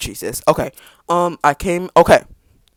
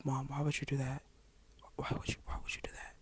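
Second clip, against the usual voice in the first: breathy whisper, much softer, like scolding under your breath; hushed disbelief; 23 dB quieter than usual. Retold or imitated. Whispering to mom, aghast that she told dad. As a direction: whisper it so dad won't hear.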